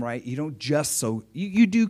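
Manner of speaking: ordinary talk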